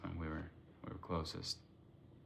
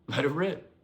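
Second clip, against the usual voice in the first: higher, loud quote of brother's rallying call; loud catchphrase; 8 semitones higher, 9 dB louder than usual. Retold or imitated. Sister or brother quoting loud sibling's motto at a meeting, loudly. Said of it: "He was loud".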